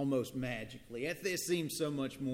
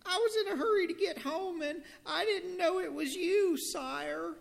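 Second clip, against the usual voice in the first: very high pleading squeak; squeaky mouse; 3 dB louder than usual. Acted. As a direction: beg like a tiny mouse. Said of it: "squeaked the little brown mouse".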